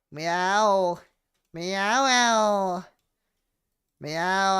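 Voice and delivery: high, drawn-out mock meows; loud cat yowl. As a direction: yowl like a cat picking a fight. Plays a threatening cat.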